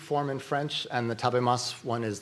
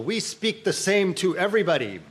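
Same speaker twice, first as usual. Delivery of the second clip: higher and louder, mocking people's denial; mock protest; 7 semitones higher, 5 dB louder than usual. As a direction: play the indignant know-it-all. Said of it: "People say".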